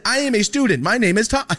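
loud, high-pitched retold outburst; indignant protest. Student identifies himself to the officer, indignant. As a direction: insist on it at full volume.

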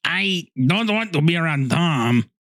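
slow, hammed-up character voice taken for Mexican; texting voice. Co-host gets back into character to reject Tom, mock texting voice. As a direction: drawl it out as a put-on texting persona. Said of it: "Texting voice".

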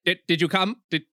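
stuttered, repeated question; stumbling repetition. Acted. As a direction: trip over the first word and repeat it. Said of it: "stumbles over himself".

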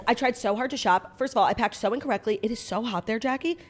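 ordinary talk

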